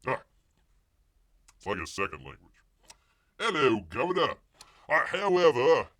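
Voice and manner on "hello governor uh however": loud, high fake British; mock Cockney accent. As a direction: overdo the Cockney, big and loud. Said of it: "best Cockney English".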